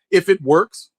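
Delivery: normal talking voice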